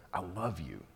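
soft, hushed I love you; tender quiet love from God; God saying I love you. Acted. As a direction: whisper it like an embrace. God voiced embracing us.

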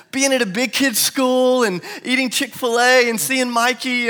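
put-on high and loud, a child's breathless list; excited kid. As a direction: rattle it off like a thrilled little boy. Son explains why it's fun.

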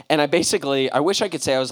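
normal talking voice

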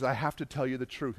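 imitated confession, soft and low; subdued, contrite murmur; tearful confession. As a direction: confess quietly, voice dropped. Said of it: "he starts to cry".

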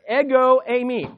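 Ego high and forceful, eimi falling; emphatic Greek phrase. Shown as a demonstration. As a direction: punch Ego, let eimi drop. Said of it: "It's very emphatic, it's very forceful".

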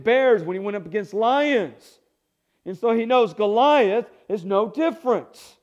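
a preacher climbing toward the climax; preaching at full force. Acted. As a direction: build the congregation up with fervor.

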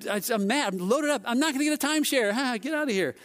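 high-pitched indignant rant; mad. Retold or imitated. Loaded-up tourist refusing a timeshare, mock mad. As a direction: replay a comic fit of temper. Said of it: "I was mad".

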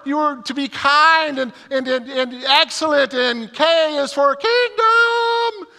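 high, loud hammed-up church lady praying; high, loud mock preaching voice; church lady prayer. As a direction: mimic a fervent church lady in full flow. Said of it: "praying this really powerful prayer".

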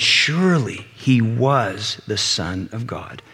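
normal talking voice

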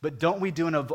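normal talking voice

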